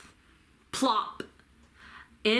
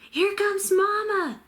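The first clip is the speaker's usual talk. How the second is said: urgent high bird warning; high little bird voice; 11 semitones higher, 4 dB louder than usual. A narrator's reading. Baby bird Pip warning his siblings, high and urgent. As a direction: warn the others, pitched high. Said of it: "hissed Pip".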